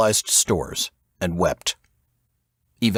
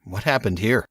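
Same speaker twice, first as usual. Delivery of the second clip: ordinary delivery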